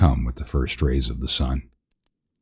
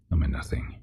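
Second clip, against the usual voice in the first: whisper; 9 dB quieter, faster than usual. Real, not acted. Mock self-deprecation.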